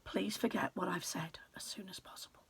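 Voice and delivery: hushed, secretive aside to the reader; conspiratorial whisper. A narrator's reading. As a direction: whisper it like a secret only we share.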